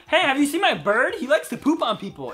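high, loud cheery quote; mock-innocent high voice; put-on game character. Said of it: "It's like".

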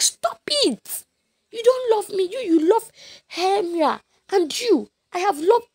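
high, loud tearful protest; high-pitched tearful pleading; upset Helena. Retold or imitated. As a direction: cry out at the men as a hurt Helena.

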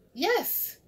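high-pitched, eager agreement; excited. A narrator's reading. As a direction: burst out in delighted agreement. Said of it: "said excitedly".

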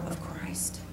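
slow, breathy hushed whisper. Acted. Telling the church nothing is beyond Christ, whispered. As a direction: drop to a whisper to land the point. Sermon climax, dropped to whisper.

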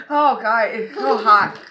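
loud burning-mouth cry; pained outburst. Real, not acted. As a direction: shout it out through the burn.

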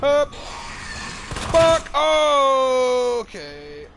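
high, loud startled outburst; yelp, then stretch out the last word high. Real, not acted. Player caught off guard in a game, startled yelp.

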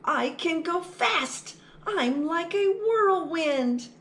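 high, bright boasting little mouse; excited little mouse. Acted. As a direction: show off like a tiny mouse who's fast. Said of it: "little mouse boasting".

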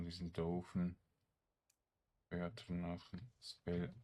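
ordinary talk

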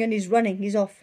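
ordinary delivery

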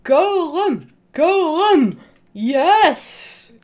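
high, loud joyful shouts; excited cheering. Real, not acted. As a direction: cheer the golem on like a fan. Cheering golem's fight.

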